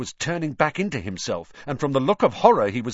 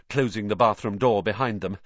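plain reading voice

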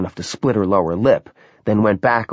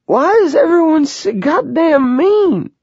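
slow, much higher, louder wailing sob; sobbing; 6 dB louder than usual. A narrator's reading. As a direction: wail it through tears. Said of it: "she sobbed".

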